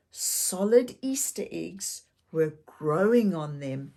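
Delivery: ordinary delivery, nothing marked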